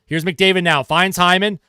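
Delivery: brisk hockey play-by-play call; sportscaster calling the action. Acted. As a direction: call the game live as it happens. Live play-by-play of hockey game.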